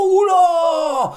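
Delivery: loud, high shocked cry; mock shock. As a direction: cry out in fake alarm. Exclamation, shouted, high.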